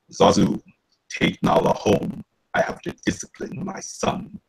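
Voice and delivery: slow, weighty lion-king voice; measured fatherly command with long pauses; Mufasa. Acted. Voice actor quoting a favorite Lion King line as a stern father, Mufasa impression. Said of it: "one particular line I like saying".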